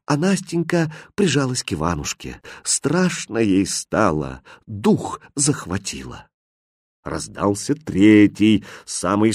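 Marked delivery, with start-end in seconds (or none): none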